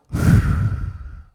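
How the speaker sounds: long loud breathing-out sigh; loud exhale sigh. Shown as a demonstration. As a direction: blow the breath out hard. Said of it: "Let me show you what you do".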